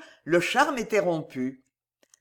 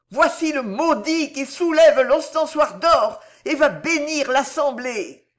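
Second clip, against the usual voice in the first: high, loud raving; madwoman's howl; 8 semitones higher, 7 dB louder than usual. A narrator's reading. Madwoman raves at the cardinal and her father, howling. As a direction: cry out in frenzy, high and loud. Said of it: "hurla la folle".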